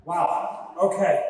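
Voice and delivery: usual speaking voice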